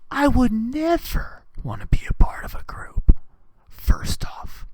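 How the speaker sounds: unvoiced, secretive whisper. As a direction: hush it like a secret. Whispered aside in a rant.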